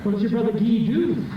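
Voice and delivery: high cheeky French puppet voice; a sassy little dummy answering back; ventriloquist dummy. Acted. Ventriloquist banters with his French dummy Cecil, high puppet voice.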